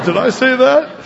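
high, loud playful disbelief; mock astonishment. Preacher plays someone shocked at blessing a coworker, mock astonished. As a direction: act startled by your own words.

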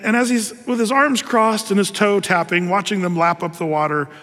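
usual speaking voice

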